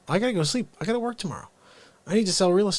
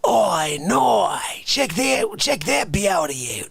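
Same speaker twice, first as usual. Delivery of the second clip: put-on crocodile hunter in a higher, louder Australian accent; 8 semitones higher, 7 dB louder, much slower than usual. Imitating the crocodile hunter for chat, loud Australian accent. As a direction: play the excited Australian croc man. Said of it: "he's always like".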